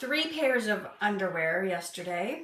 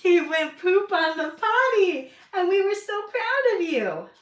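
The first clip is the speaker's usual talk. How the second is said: potty training praise; 10 semitones higher, 10 dB louder than usual. Real, not acted. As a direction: cheer him on proudly. Praising toddler for potty success.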